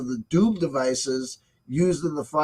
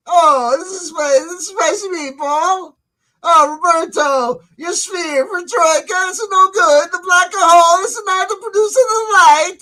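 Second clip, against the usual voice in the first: high, loud, hammed-up Italian accent; mock Italian accent; 10 semitones higher, 8 dB louder, slower than usual. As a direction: ham up a cartoon Italian accent, calling out in mock outrage. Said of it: "I don't speak a lick of Italian".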